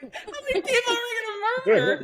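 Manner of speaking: very high, laugh-choked exclamation; high-pitched mock outrage breaking into laughter; laughing disbelief. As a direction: squeal it through laughter, incredulous. Retold incredulous reaction while laughing.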